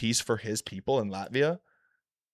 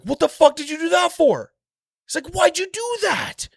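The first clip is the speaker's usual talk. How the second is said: much higher, loud, cackling-edged Joker squeal; 8 dB louder than usual. Retold or imitated. Joker shrieking at Scarecrow over Batman's unmasking, high and loud. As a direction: shriek it like the Joker whose fun got ruined. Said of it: "Joker's like".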